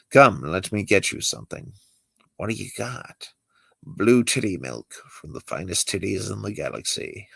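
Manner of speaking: ordinary talk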